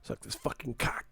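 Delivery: unvoiced whispered taunt. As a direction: hiss it as a teasing whisper.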